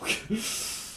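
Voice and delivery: brief breathy laugh at a mishap; quick voiceless chuckle; short laugh. Real, not acted.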